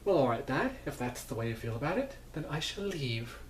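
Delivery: mock wounded son, lightly voiced; a hint of the hurt son, pitch lifting; Hatter. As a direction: answer dad with lightly acted injured dignity. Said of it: "the Hatter's like".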